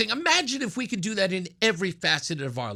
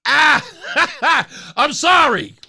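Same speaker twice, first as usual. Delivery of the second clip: bursting out laughing, louder; loud laughter; 4 semitones higher, 6 dB louder, much slower than usual. Real, not acted. Host cracking up at his own joke, laughing loud. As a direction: laugh hard, then apologize.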